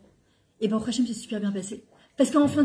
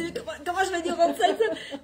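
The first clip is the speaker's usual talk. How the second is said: laughing; 6 semitones higher than usual. Real, not acted. Laughs while quoting herself.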